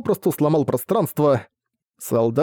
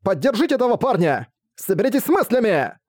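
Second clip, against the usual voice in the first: shouting orders; 8 semitones higher than usual. Acted. Commander rallying frozen troops.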